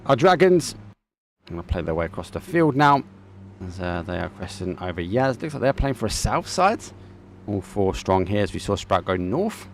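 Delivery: brisk esports commentary, lively and running; live play-by-play. Acted. Caster narrating the final game to viewers, lively play-by-play. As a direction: call the action for the stream.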